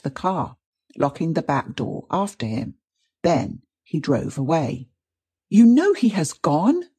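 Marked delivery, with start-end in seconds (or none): 5.5-6.8 higher, louder indignant outcry; crying out. A narrator's reading. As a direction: fling it at him in outrage. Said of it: "cried Missus Haldane".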